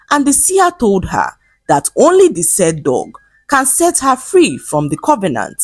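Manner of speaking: ordinary delivery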